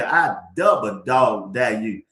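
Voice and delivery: low, slow, drawn-out dare; lightly voicing the old folks; old folks' dare. Quoting what the old folks say, slow and low. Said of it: "they say".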